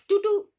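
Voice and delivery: high, loud mimicked noise; imitated background noise. Imitates sound heard on phone.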